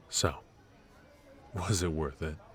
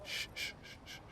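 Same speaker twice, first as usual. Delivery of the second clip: hushing; 13 dB quieter, much faster than usual. Acted. Hushing someone in public.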